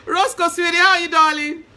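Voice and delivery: laughing through a loud, high hello; laughing greeting. Real, not acted. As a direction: greet him, giggling with joy. Greeting another viewer, laughing.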